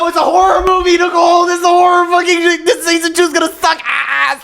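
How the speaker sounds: high, loud shouted rant; outraged rant. Real, not acted. Friend dreading season two, high shouted rant. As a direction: yell your dread at the plot. Listener ranting about the show.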